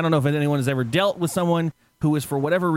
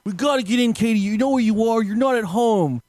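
raised-pitch exasperated pleading; imitated frustration; 6 semitones higher than usual. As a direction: play a fed-up man scolding his companion. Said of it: "he starts getting frustrated".

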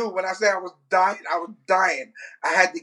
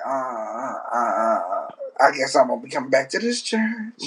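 mock embarrassed backpedal; sheepish stalling; 7 semitones lower than usual. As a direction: stall awkwardly in a lowered voice. Acts out own embarrassed excuse.

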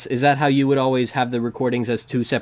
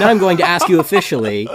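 louder, higher announcer read; hammed-up radio announcer; 5 semitones higher, 5 dB louder than usual. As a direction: announce it like a show host. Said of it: "keep up that voice for so long".

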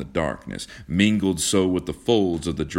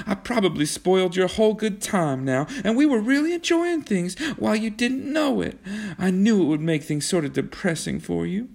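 put-on high-pitched, chattering girl's voice; defiant young woman; 14 semitones higher than usual. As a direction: defend yourself in a high girlish voice.

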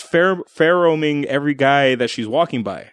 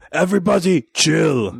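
higher, exaggerated villain proclamation; hammy villain catchphrase; 4 semitones higher than usual. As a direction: belt it like a cheesy villain one-liner. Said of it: "How you go from that to".

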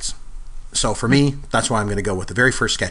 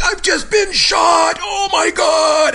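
high, loud overacted death cry; hammy stage actor; 5 dB louder, much slower than usual. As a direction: ham up a melodramatic death scream. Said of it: "just overacting the whole thing".

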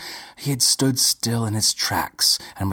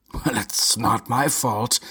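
annoyed; 2 semitones higher than usual. A narrator's reading.

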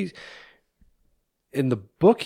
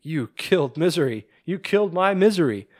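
retold accusation; 6 semitones higher than usual. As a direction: imitate her rising outrage at the writer. Retelling her fury at Paul.

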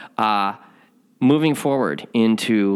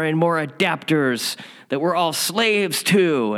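ego ranting; 6 semitones higher than usual. Retold or imitated. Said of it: "that reptilian animal brain, that ego".

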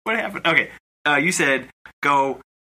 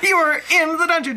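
laughing; 3 dB louder than usual. Real, not acted. Narrating while laughing.

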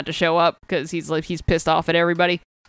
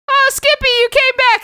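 much higher, louder thrilled cry; the gang cheering the returning hero, squealing with delight; overjoyed greeting; 5 dB louder than usual. Retold or imitated. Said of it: "they're like".

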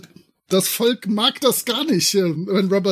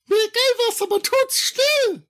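helium voice; 12 semitones higher than usual. Acted. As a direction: panic cries on helium. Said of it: "dieses Helium tödlich".

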